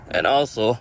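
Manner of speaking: normal talking voice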